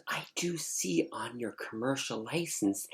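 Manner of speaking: hushed whisper of a secret; whispered reveal. Retold or imitated.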